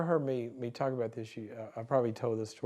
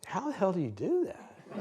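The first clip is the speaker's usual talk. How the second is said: bewildered; 7 semitones higher than usual. Retold or imitated. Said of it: "I'm sitting here bewildered".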